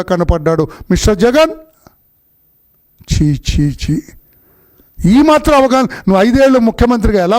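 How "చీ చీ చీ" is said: low, scornful chee chee chee; disgusted tut. Real, not acted. Politician scoffing at Jagan's ignorance, low disgusted tut. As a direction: spit the words out in contempt.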